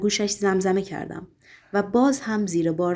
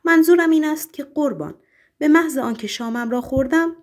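joyful stammer; 7 semitones higher than usual. A narrator's reading. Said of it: "سرمست از خوشی لبخندی زد و با لکنت زبان گفت".

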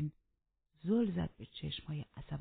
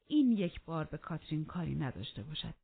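ordinary delivery, nothing marked

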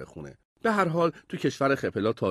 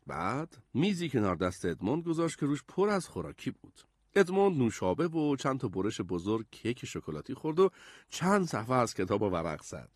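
usual speaking voice

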